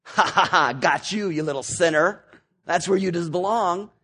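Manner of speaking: loud mocking cackle, gloating at the sinner; gloating laugh. Abraham mocking the rich man in hell, gloating laugh. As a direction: laugh him down, mean and triumphant. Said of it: "Abraham is not saying".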